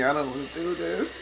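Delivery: hushed nervous mutter; scared, pulse pounding, mumbling; heart racing. Real, not acted. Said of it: "Oh, my heart is racing".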